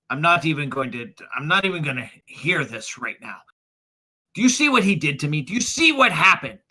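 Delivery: indignant, rising outburst; heated refusal. Acted. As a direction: refuse to listen, then throw it back at them. Magistrate quarrels, refuses to hear.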